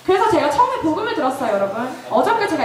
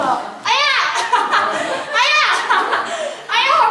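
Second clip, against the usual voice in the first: fake pained cries; 10 semitones higher, much slower than usual. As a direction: cry out as if being hit, to fool mom. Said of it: "막 우는 소리 내고". (맞는 척 높게 우는 소리)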